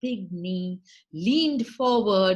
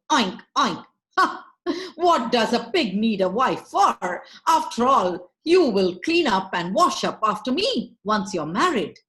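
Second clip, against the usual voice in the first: brash, much louder swaggering pig; loud boastful pig; 7 dB louder than usual. Acted. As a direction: crow it out like a smug pig. Pig boasting loudly to Meenakshi.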